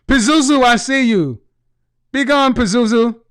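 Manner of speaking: loud mock exorcist commanding; hammed-up loud exorcism of Karen. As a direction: cast the demon out, hammed-up and loud.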